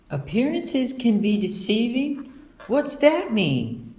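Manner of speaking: put-on high, slow, puzzled little girl; Sister. Little sister asking mother about a saying, puzzled.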